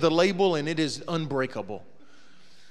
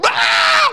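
sudden high yelp of falling; a short piercing "Ah!" thrown out like a man tumbling downstairs; mock scream; 13 dB louder than usual. Old classmate yelping down the steps, sharp high scream.

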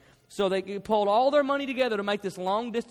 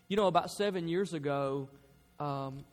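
ordinary delivery

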